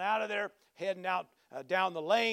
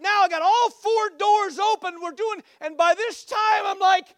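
loud, high-pitched, mounting panic; frantic storytelling; 14 semitones higher, 12 dB louder than usual. Retold or imitated. Man chasing a dog round his truck, frantic shouted account. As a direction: holler the chase, getting more frazzled.

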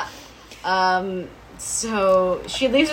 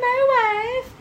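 swooning echo; 14 semitones higher than usual. Retold or imitated. Mocking Dawn's swooning reaction.

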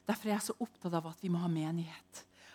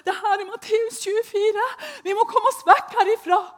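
much higher, louder, with a laugh in it; frantic plea; 11 dB louder than usual. Retold or imitated. Telling the others to flee, high frantic half-laughing plea. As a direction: plead with them, half laughing in desperation. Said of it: "jeg prøvde å si til dem".

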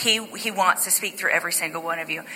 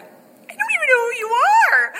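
much higher and louder, laughing through it; laughing disbelief; 6 dB louder than usual. Retold or imitated. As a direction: blurt it out, laughing and half crying. Retold reply, laughing.

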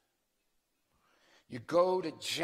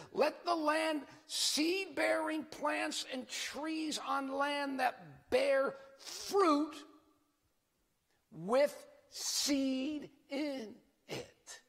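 very slow, raised Bible reading; scripture reading; 10 semitones higher, much slower than usual. Acted. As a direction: stretch every word of the verse. Rereading the verse slowly.

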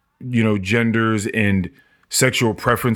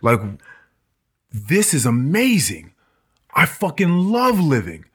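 rising to a higher thrilled cry; amazed and thrilled; 4 semitones higher than usual. Retold or imitated. The immortal man raves to Dream about living, thrilled and high. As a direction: marvel at your own immortality. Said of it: "the guy's just like".

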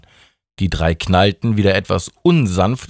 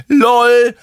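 high, loud laughing lol; mocking lol; 6 dB louder than usual. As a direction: chime in mocking. Mike chimes in laughing. (hohes, lautes Lol)

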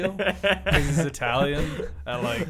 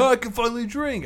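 mock excitement; 12 semitones higher, 6 dB louder than usual. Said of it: "I was like".

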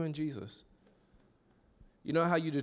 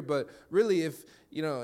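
usual speaking voice

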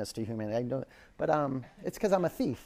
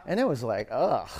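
ordinary delivery

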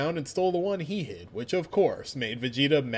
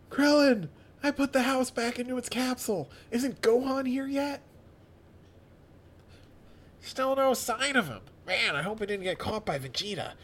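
high voice; 7 semitones higher than usual. Acted. Voicing Krillin.

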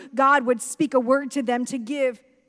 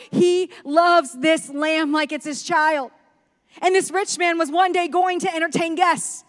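rising higher and louder as the injustice grows; building preaching; 5 semitones higher, 6 dB louder than usual. Acted. Preacher to congregation telling the parable, building loud. Preaching the story at full force.

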